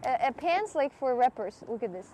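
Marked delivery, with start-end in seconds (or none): none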